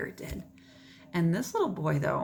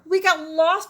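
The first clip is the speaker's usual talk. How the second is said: higher, much louder cry; cried out; 10 semitones higher, 10 dB louder than usual. Acted. Paco finding Maggie after getting lost, crying out. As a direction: blurt it out in relief. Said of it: "cried Paco".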